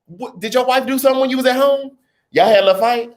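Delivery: high mock-concerned voice; sassy mock worry. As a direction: tease him like a worried friend. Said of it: "I'm looking at you like".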